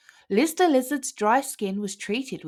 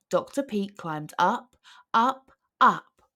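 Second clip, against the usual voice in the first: ordinary delivery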